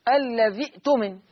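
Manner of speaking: short ذي caught by a glottal stop in "الذي اؤتمن"; Hafs reading. Shown as a demonstration. Teacher demonstrating Hafs for the class, "الذي اؤتمن" with hamza sounded. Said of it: "حفص بيقول كده".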